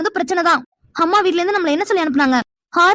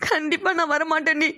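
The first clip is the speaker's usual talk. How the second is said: crying; 5 dB quieter than usual. Acted. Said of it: "அழுதுட்டு இருக்கா".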